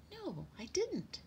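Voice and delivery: slow, high sparrow voice; little bird realising gradually; thinking back. A narrator's reading. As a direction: recall it slowly, pitched high. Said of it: "thinking back".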